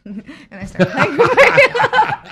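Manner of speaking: loud laughter through the words; cracking up mid-sentence; laughing. Real, not acted. Laughs while telling story.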